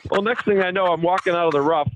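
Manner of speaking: normal talking voice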